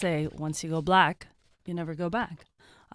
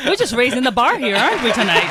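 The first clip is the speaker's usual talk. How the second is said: sarcastic; 7 semitones higher, 13 dB louder than usual. Real, not acted. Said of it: "Oh, oh, wow".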